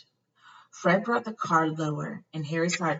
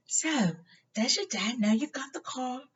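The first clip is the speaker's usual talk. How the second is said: higher, soft whispered question; Harry whispering; 8 semitones higher, 3 dB quieter than usual. A narrator's reading. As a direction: hush it, sneaky.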